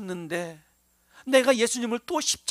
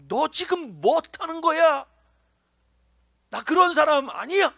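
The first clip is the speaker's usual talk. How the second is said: raised, sharp voice snapping back in denial; defensive denial; 6 semitones higher, 6 dB louder than usual. Retold or imitated. Peter snapping loudly at the servant girl, defensive. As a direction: push her away with a loud, rattled protest.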